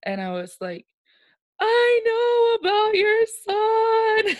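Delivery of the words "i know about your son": loud, high, drawn-out mock wail; slow comic sobbing howl, acted out; crying blurt. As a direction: bawl out a confession, hammed up. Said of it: "And then I started crying more".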